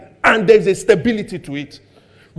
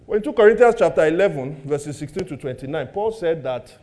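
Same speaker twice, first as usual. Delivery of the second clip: ordinary talk, nothing marked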